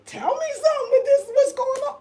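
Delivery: high-pitched mock plea; pleading for help. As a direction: squeal it like a desperate appeal. Retold plea to her companion.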